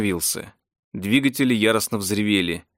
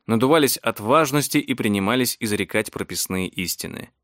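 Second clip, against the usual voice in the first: ordinary delivery, nothing marked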